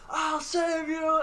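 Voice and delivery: high, slow, loud heroic shout to the rescue; heroic cry. Retold or imitated. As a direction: bellow it like a hammy hero charging in. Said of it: "he's like".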